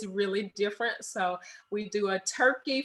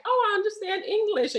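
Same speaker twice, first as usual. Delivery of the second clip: naive self-quote; 10 semitones higher than usual. Retold or imitated. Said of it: "you're just gonna walk over here and like".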